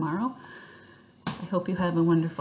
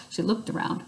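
ordinary delivery, nothing marked

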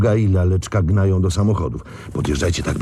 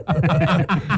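a pleased little laugh; satisfied laugh; 10 semitones higher than usual. Acted. Boss laughing to Max about the haul. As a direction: chuckle over the big loss for Nowak.

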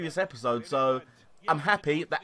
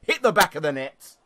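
stern word; 7 dB louder than usual. Pretend. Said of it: "having a stern word".

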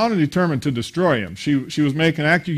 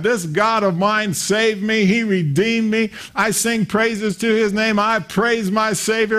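slow, raised praise to God; fervent praise; 6 semitones higher, slower than usual. Retold or imitated. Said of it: "he's praising God".

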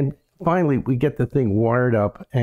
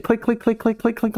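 rapid high clicks like a flapping reel; imitated projector clicking; 10 semitones higher than usual. Teller mimics the runaway projector with sharp clicks. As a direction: mimic the film flopping off the reel. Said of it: "like this, right?".

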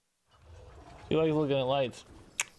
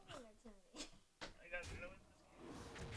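whispered to himself; 28 dB quieter than usual. Real, not acted. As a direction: whisper it under your breath. Same words whispered.

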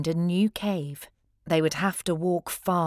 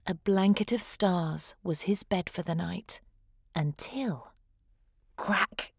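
plain reading voice

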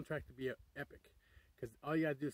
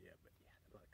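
whisper; 9 semitones lower, 24 dB quieter, much faster than usual. Real, not acted. Whispering an aside about the clapping noise. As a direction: breathe it out as a hushed aside.

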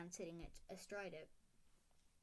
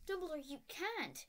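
higher, indignant stern witch, a bit louder; outraged teacher voice, pitched up; McGonagall indignant; 6 semitones higher, 4 dB louder than usual. A narrator's reading. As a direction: protest to Dumbledore with rising alarm. McGonagall protesting in story.

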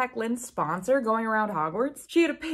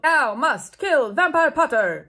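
1 villain command; 5 semitones higher, 5 dB louder, slower than usual. A narrator's reading. Villain commands in archaic speech.

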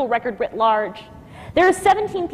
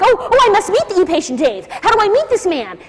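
excited; 7 semitones higher, 5 dB louder than usual. Retold or imitated. Excited quote of herself meeting news.

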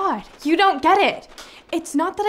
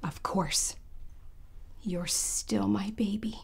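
soft, slow motherly whisper; tender whisper; 5 semitones lower, 8 dB quieter, slower than usual. Acted. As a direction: reassure him gently in a whisper. Tender mother comforting her son.

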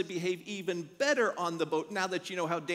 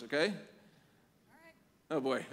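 ordinary talk

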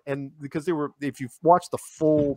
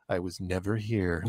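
hushed secret; 5 semitones lower, 7 dB quieter, slower than usual. Retold or imitated. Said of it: "He goes".

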